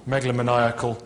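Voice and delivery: normal talking voice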